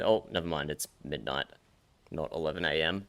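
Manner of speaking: ordinary talk, nothing marked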